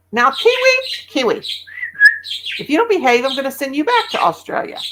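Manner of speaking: high, mock-stern warning to a bird; mock scolding. Real, not acted. Owner warns parakeet Kiwi to behave, mock-stern and high.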